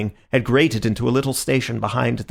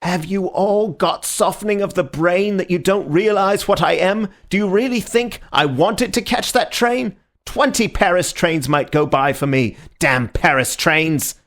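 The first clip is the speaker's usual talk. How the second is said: desperate rage; 8 semitones higher, 3 dB louder than usual. A narrator's reading. Marquis raging in desperation.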